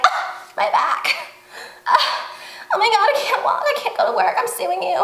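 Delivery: high-pitched, hammed-up moaning complaint; fake injury whine. Fender-bender victim claiming injury to sue, mock whining. Mimics people faking injury after crash.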